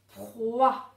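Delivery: "proie" blended, long r swelling into a loud wa; sounded-out syllable. Shown as a demonstration. Teacher blending the sounds for pupils, "proie" drawn out. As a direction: stretch the pr of "proie", then land loudly on wa. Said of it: "on entend d'abord le p".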